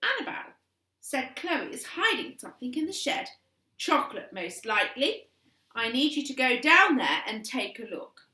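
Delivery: scheming mum. A narrator's reading. Mother accuses Chloe.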